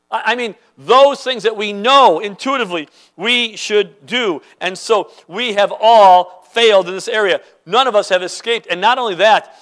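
ordinary talk, nothing marked

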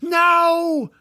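long, high, loud cry of refusal; yelling. A narrator's reading. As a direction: yell out in urgent protest. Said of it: "George yelled".

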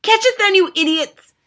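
high, loud witch's scream; screamed order. A narrator's reading. Grand High Witch scolds her idiot witches, screamed order. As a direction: scream the command at useless underlings. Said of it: "screamed the Grand High Witch".

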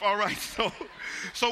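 real laugh fading. Real, not acted. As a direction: settle down from laughing. Laugh subsiding.

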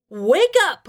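high, loud yelled order. A narrator's reading. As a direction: yell to rouse a sleeper. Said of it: "I yelled".

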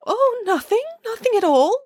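high, falsely bright denial; lying mother duck. A narrator's reading. Mother duck lies to her duckling, high and bright. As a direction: cover the lie with a high cheerful voice. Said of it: "lied the mother duck".

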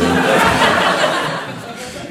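a loud, airy laugh; hearty laugh. Real, not acted. Father laughing about the family being in counseling, hearty laugh.

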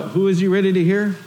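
ordinary talk

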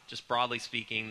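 normal talking voice

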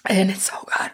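breathless whispered rave; gushing whisper. Real, not acted. Reader raving to co-host about a favourite book, whispered. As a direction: whisper it, savouring every word.

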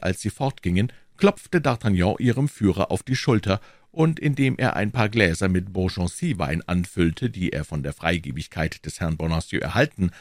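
ordinary delivery, nothing marked

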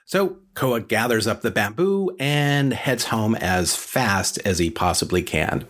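ordinary delivery, nothing marked

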